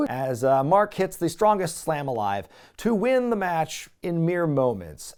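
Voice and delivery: usual speaking voice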